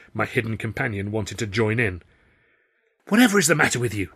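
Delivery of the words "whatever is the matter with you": fast, higher, louder prim scolding; hissed rebuke. A narrator's reading. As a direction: snap at him in the wings. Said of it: "Tilly hissed again".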